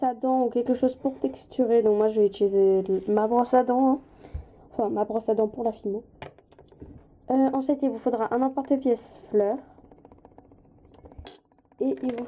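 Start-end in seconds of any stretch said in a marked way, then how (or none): none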